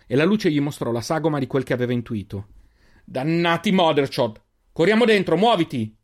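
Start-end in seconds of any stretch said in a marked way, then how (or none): 3.0-6.0 furious shout to run inside; angry curse and order. A narrator's reading. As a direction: curse hard, then bark the order. Cursing, urging friends to run.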